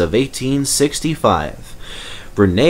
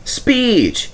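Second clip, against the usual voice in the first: shouted call; 13 semitones higher than usual. Acted. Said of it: "shouted someone".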